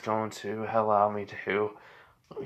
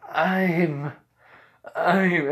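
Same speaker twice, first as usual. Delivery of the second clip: higher, louder stammer; flustered; 7 semitones higher, 6 dB louder than usual. Acted. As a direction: stammer it out, caught off guard. Flustered at being called Papa.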